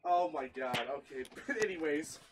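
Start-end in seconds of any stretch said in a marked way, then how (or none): none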